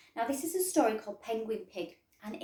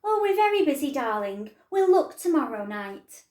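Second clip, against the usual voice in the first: higher, louder, breezy busy-parent voice; busy mum; 4 semitones higher, 9 dB louder than usual. Acted. Busy mum putting off her excited daughter, breezy. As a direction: brush the child off sweetly.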